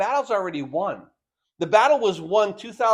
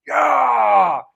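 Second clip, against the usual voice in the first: loud, high war cry; battle shout; 5 semitones higher, 8 dB louder than usual. Retold or imitated. Old movie army charging the other army, loud battle cry. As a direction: charge the enemy with a yell. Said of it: "They'd shout".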